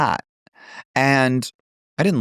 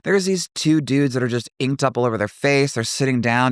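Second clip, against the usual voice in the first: usual speaking voice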